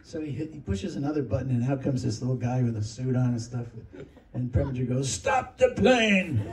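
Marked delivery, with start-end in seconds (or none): 5.1-6.4 slow, much higher, loud mock command; hammed-up shout; shouted order. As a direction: bellow it out, dragged long. Preminger orders the assistant.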